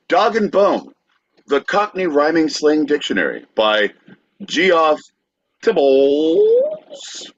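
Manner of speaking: hammed-up ad read, high on the name; mock sponsor read. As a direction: sell the sponsor like a cheesy radio spot.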